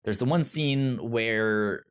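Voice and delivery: ordinary delivery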